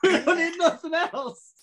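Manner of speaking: put-on loud laughing drunk boast; drunken shout. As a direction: shout it like a tipsy lad. Friend imitates drunk self.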